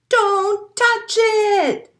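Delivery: high, loud, slow warning; mother's warning. Acted. Mother penguin warns her little one. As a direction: warn the chick sternly. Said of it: "says his mother".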